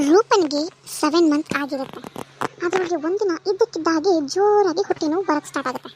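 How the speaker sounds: normal talking voice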